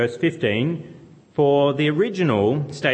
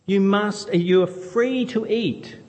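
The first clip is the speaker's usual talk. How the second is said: a hint of a doting parent, higher and slow; generous God urging; 7 semitones higher, slower than usual. God telling Adam he may eat, lightly voiced doting parent. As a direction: lean into free to eat, generously. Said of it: "He said".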